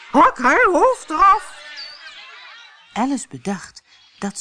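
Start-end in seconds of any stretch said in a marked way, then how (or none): 0.1-1.6 very high, loud, slow scream; screaming queen. A narrator's reading. Queen screams for the gardeners' heads, very high, loud and slow. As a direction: roar the sentence at them. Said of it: "Ze begon te schreeuwen".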